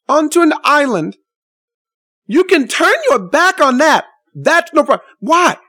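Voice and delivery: high, heated, near shouting; outraged rebuke. Retold or imitated. Scolding the woman who turned away, heated and high. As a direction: hammer her with outrage. Angry retold rebuke, raised pitch.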